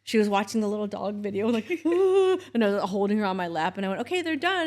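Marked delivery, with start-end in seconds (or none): 1.8-2.4 mock high child's ah. Imitating child's reaction. 4.0-4.7 high cheerful mom announcement. Retold or imitated. Mother announcing shots done.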